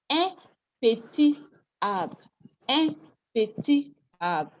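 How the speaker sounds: "Un petit arbre" word by word, silent final t in petit; slow teacher model of "Un petit arbre", no linking T; without liaison. Shown as a demonstration. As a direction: separate "petit" and "arbre" with a small break. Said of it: "So when you do liaison here".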